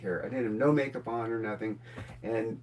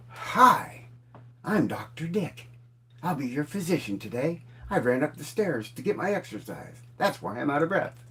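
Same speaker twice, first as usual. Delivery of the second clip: doctor's entrance; 3 semitones higher than usual. Retold or imitated. Doctor bursts into a patient's room after running stairs, imitated hearty introduction. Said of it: "this person comes in and he goes".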